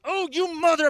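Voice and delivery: high, loud outburst at the driver; road rage curse. Retold or imitated. Dad cut off in traffic, loud curse. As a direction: start to swear out loud. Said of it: "the first thing I wanna say".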